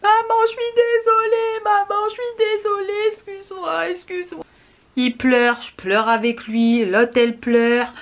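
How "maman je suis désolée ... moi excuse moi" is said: put-on child's crying wail, much higher, fading off; screaming child. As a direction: wail like a sorry little boy, then fade. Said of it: "il hurlait".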